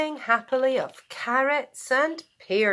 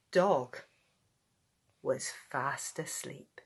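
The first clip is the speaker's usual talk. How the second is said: soft, fading sleepy voice; 6 semitones lower, 8 dB quieter than usual. A narrator's reading. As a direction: let it fade into sleep. Sleepy ending.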